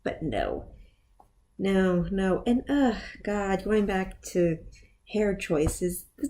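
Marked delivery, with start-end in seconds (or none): none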